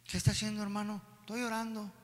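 hushed, barely audible exchange; small soft voice, as if not to disturb; timid praying voice. Acted. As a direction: imitate someone praying too softly to be heard. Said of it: "No estaba ahí así como algunos oramos".

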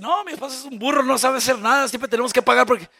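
loud, fast mock griping; complaining wife. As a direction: run him down in public. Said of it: "No venga a decir".

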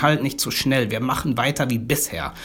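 ordinary talk

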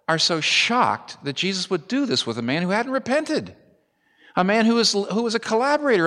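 high, urgent pulpit voice; impassioned preaching. Acted. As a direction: drive home the crowd's shock. Crowd's shock, preached at full force.

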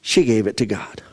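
hushed, awed; reverent whisper. Acted. Jesus's hushed reverent climax.

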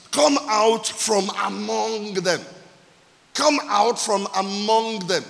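"come out from among them" slow, every word weighted; emphatic key words. Shown as a demonstration. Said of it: "that will be my emphasis".

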